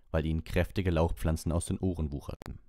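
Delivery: plain reading voice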